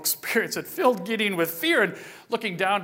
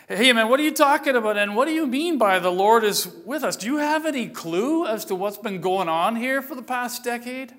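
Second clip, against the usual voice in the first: exasperated protest. Acted. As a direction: blurt out the grievances. Gideon protests to the stranger.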